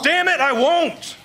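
loud, high, slow refusal; defiant shout. Acted. Man refusing the hoodoo woman's order, defiant shout. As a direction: throw it back at her like a fist. Argues with guide.

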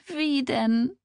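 high, tearful helpless question; weeping. A narrator's reading. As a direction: ask it helplessly through acted tears. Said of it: "während sie lautlos weinte". (hilflos weinend)

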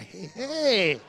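jogging neighbors calling out a little too friendly; overeager neighbors. Retold or imitated. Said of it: "they act like they jogging by".

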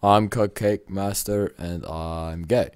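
deadpan. Acted. Reading joke character name.

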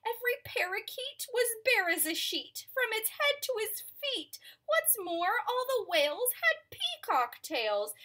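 very high, loud, pleading birds; high bird voices. Acted. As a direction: chirp out the complaint, begging. Birds complain to elephant.

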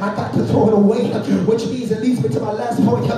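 full-force sermon delivery, driving phrase by phrase; preaching. Real, not acted. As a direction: preach hard to the congregation, punching each phrase.

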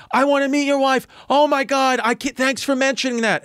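high, thrilled mom voice; gushing mom. Retold or imitated. Owner's wife thanks the comic, high and gushing. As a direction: gush like a delighted mother.

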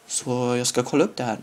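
usual speaking voice

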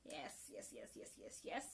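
breathless whispered yes, yes, yes; whispered cheer. Retold or imitated. Cheering Nyanzi's defiance under her breath, whispering. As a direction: hiss the yeses in secret delight. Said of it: "And I was like".

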